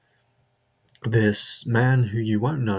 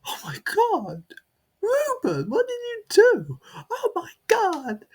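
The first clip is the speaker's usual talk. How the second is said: much higher, wailing mock panic; hysterical crowd. Coworkers crowd round Rupert, shrieking in mock panic. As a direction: ham up a crowd shrieking in horror. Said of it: "everyone is crowding around going".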